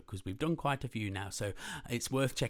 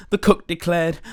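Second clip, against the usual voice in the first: lively, loud read-aloud storytelling; animated picture-book reading; story narration; 8 semitones higher, 17 dB louder, slower than usual. A narrator's reading. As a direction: read it big and bouncy for kids.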